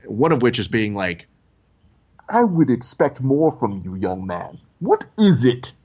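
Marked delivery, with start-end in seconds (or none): 2.3-5.7 imitating a stern, imposing dark lord; measured villain voice, formal and commanding; Darth Vader. Costumed villain challenges a young visitor, stern theatrical impression. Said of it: "fully committed to character".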